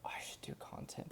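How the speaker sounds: soft whispered self-reminder. Retold or imitated. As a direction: barely breathe it out to yourself. Quoting own daily thought.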